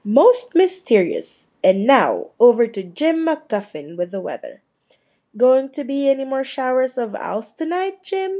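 brisk TV newscaster delivery; news read. Acted. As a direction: read it like the evening news anchor. Newscaster continues.

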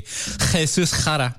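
Jesus Jara with throaty Spanish H's, "khe-SOOS KHA-ra"; exaggerated Spanish pronunciation. Shown as a demonstration. Co-host offering another reading of the name, throaty Spanish pronunciation. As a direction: say Jesus Jara with rasped J's and tapped R.

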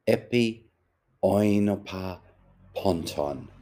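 Epi oinopa ponton as slow, sounded-out Greek; Greek phrase. A narrator's reading. Buck Mulligan recites Homer's Greek to Stephen, sonorous. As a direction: give each Greek word its weight. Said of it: "read them in the original".

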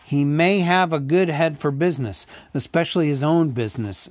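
ordinary delivery

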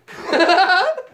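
high loud burst of laughter; big laugh. Real, not acted. Teller laughs at scene.

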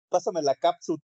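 ketchup said as "cátsup", written here "cápsula"; playful request with "cápsula" turned into "cátsup"; Mexicanized English word. Shown as a demonstration. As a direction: say "cápsula" as Mexican "cátsup", stressed on the first syllable. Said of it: "ni siquiera las pronuncias bien".

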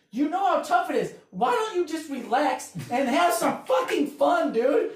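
half-shouted appeal to loosen up; exasperated plea. Acted. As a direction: push each word out, slow and emphatic. Pleading exhortation to tough crowd.

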